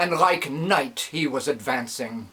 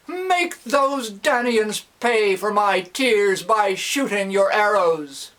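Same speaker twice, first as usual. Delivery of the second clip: high, loud fervent prayer; 9 semitones higher, 4 dB louder than usual. Acted. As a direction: cry out to the god. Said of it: "Loudly the old man".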